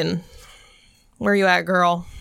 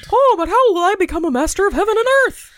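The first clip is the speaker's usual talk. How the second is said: character voice; 12 semitones higher, 4 dB louder than usual. Acted. Voicing character in audio drama.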